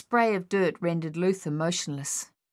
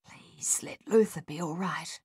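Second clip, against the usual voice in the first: a hushed unvoiced whisper; soldier's whispered plea; whispered prayer; 4 dB quieter than usual. A narrator's reading. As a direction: breathe it like a silent prayer. Silent prayer in narration.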